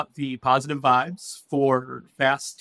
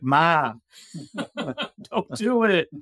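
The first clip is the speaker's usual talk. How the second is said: higher, drawn-out, laughing plea; pleading with mom; 3 semitones higher, much slower than usual. Retold or imitated. Host begging her mother to stop, slow and laughing. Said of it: "I'm like".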